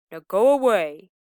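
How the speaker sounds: loud shouted command. Acted. Wolf sends the lamb off, shouting. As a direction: shout her away from the door. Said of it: "he shouted".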